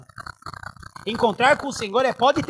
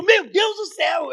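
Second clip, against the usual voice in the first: high, drawn-out cry of mock fright; startled exclamation; 8 semitones higher, slower than usual. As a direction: retell jumping out of your skin. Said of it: "eu assusto muito fácil".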